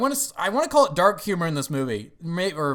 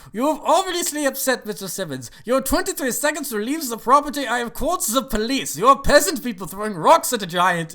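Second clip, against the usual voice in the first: high-pitched, indignant mock announcement; Dutch; 7 semitones higher, 5 dB louder than usual. Assistant warning people off the property, imitated high-pitched indignation. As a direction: imitate a fussy assistant laying down the law. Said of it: "and opens up with".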